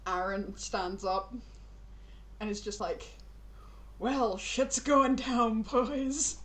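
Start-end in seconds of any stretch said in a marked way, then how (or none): 4.0-6.4 slow, slightly louder announcement; slow character voice announcing trouble; drawn-out warning. Acted. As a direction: stretch it out as a wry warning. Aaron announces trouble to the others.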